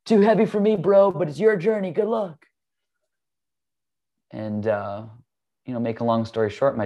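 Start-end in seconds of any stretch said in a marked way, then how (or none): 0.0-2.5 much higher, breezy surfer-bro voice; laid-back bro. Retold or imitated. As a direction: toss it off like a chill buddy leaving. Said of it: "his friend ... says".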